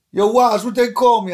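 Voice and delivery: loud boastful retold call to a friend. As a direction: show off to your friend.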